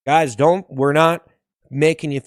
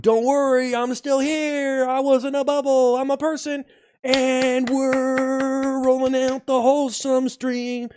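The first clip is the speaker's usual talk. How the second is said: high, drawn-out hype; raised-pitch mock cheer; playful high voice; 7 semitones higher, slower than usual. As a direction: announce it high and stretched out. Bubble act, hyping the stream.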